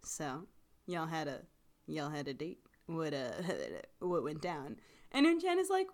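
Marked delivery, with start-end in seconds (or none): 0.0-4.8 mock badgering. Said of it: "really, like, badgering Unchan, being like".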